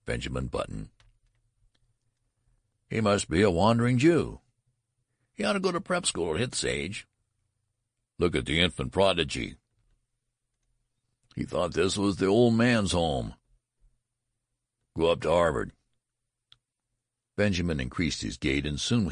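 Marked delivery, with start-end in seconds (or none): 2.9-4.4 higher, louder mocking call; jeering student. A narrator's reading. Student taunting Benjamin, loud jeering call. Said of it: "remarks aimed at". 5.3-15.7 shouted taunts from the mob; jeering crowd. A narrator's reading. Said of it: "remarks aimed at".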